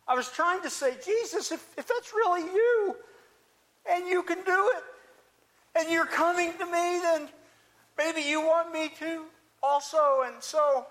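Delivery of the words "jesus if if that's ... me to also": higher, louder earnest appeal; expressing faith. Acted. Peter trying to tell Jesus he believes, earnest and raised. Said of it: "I was really expressing faith".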